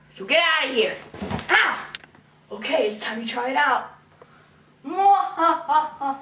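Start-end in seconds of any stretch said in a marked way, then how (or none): 4.9-6.2 evil laugh. Acted. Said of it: "I need an evil scheme".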